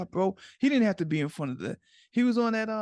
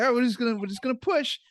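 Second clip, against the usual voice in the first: lightly voiced instructor, pitched up and louder; skydive instructor; 8 semitones higher, 4 dB louder, faster than usual. Skydive instructor to a nervous jumper about to be pushed, lightly acted. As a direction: raise the pitch for a quick mock instructor.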